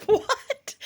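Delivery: high squeaky laughing question; incredulous laugh on the word; laughing what. Real, not acted. Laughing disbelief at the price.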